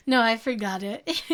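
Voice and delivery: ordinary talk, nothing marked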